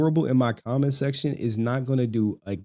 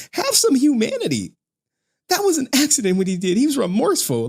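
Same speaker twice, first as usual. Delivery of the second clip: mocking chatters; 13 semitones higher, 4 dB louder than usual. Mimicking chatters defending the man.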